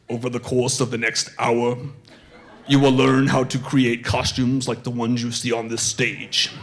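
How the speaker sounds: low, solemn villain lecturer; Darth Vader. Acted. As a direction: deliver a formal lecture in a deep villain voice. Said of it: "Vader steps up and he begins the lecture".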